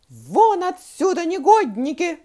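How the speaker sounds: very loud, high furious shout; terrible yell. A narrator's reading. Teacher throwing two pupils out, yelling terribly. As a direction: yell them out of the room. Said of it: "закричала она страшным голосом".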